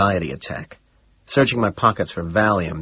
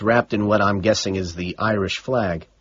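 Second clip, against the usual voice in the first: plain reading voice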